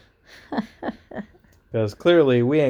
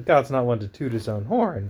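ordinary delivery

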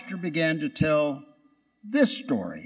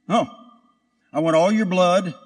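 sneering and louder; 2 semitones higher, 4 dB louder than usual. Retold or imitated. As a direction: spit it out with contempt. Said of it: "Satan looked at Jesus and sneered".

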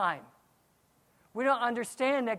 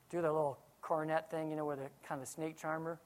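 normal talking voice

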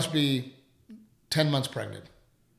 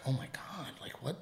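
stunned onlookers; 10 dB quieter than usual. Retold or imitated.

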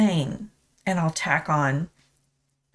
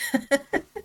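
a short, high, tearful laugh; 7 semitones higher than usual. Real, not acted. Tearful laugh as she shares scripture with viewers. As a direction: laugh through the tears to steady yourself.

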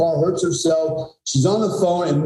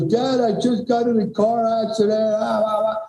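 higher, breathless retelling of a frantic call; imitating a panicked daughter; 7 semitones higher than usual. Daughter phoning her dad after the crash, mock frantic high voice. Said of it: "she's just losing her mind".

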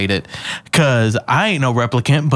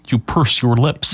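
Texas accent demo; 4 semitones lower than usual. Shown as a demonstration. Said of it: "It's a fun accent to do".

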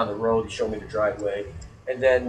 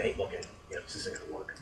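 a hushed, voiceless complaint; whispered complaint; 17 dB quieter than usual. Retold or imitated. Planning to tell the tour guide it won't work, whispered. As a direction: whisper it under your breath.

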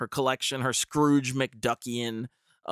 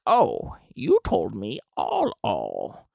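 put-on grating old lady voice; 3 semitones lower, 3 dB louder, slower than usual. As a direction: make it grate, slow and whiny. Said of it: "this voice is probably getting pretty grating".

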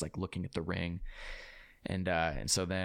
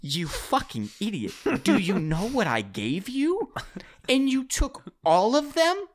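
slow, incredulous rant building high and loud; mock furious stepmom; 11 semitones higher, 6 dB louder, slower than usual. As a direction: imitate a hardcore East Coast scolding, stretched out.

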